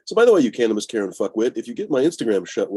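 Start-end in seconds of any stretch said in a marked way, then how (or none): none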